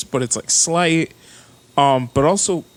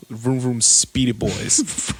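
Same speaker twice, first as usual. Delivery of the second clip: drawn-out mock nickname; 3 semitones lower, slower than usual. Savoring his nickname for racers.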